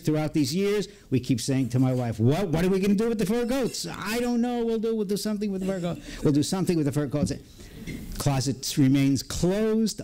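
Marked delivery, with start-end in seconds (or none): 2.2-3.9 high, rushed, pestering question; exasperated nagging. Retold or imitated. Husband keeps asking wife about the coats, exasperated. 3.9-7.3 high, very fast mock put-off; flustered brush-off. Wife puts off husband's question about the coats, hurried brush-off.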